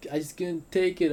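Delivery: loud, high shouted defense; yelling back. Acted. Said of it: "I yelled back".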